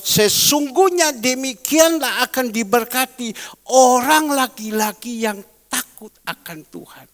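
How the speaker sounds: solemn Bible reading; scripture reading. Acted. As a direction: read the verse as holy words. Reading scripture, slow and loud.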